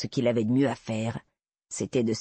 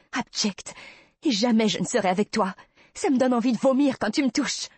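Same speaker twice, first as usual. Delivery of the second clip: much higher and louder revulsion; disgusted outburst; 10 semitones higher, 3 dB louder than usual. Acted. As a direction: let the disgust burst out. Heroine's disgust swells to a cry. (dégoût qui éclate, bien plus aigu et fort)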